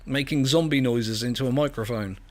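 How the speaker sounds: normal talking voice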